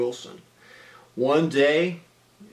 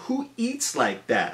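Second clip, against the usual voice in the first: mock disbelief; 10 semitones higher than usual. Kid thinking about lima beans.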